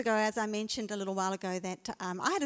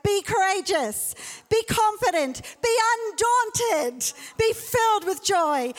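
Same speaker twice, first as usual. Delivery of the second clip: high, loud, slow rising proclamation; preaching; 9 semitones higher, 5 dB louder, slower than usual. Acted. Pastor proclaiming scripture to the church, loud and high. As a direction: proclaim each line bigger.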